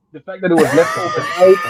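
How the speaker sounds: laughing through the words; breaking into laughter on overnight. Real, not acted.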